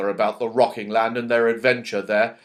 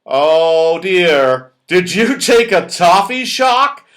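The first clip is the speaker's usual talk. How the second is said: higher, louder concerned Moonface; 4 semitones higher, 8 dB louder, slower than usual. Acted. Moonface worried about Joe's toffee shock. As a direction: fuss kindly over the shocked friend. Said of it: "said Moonface".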